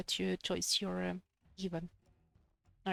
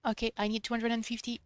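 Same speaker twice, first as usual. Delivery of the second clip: usual speaking voice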